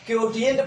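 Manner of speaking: "क्योटिएंट" with a hard T after KYO; mispronounced quotient. Retold or imitated. Teacher reports how some people read it, mispronounced. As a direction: say "क्योटिएंट", T in place of SH. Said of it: "कुछ लोगों ने इसको".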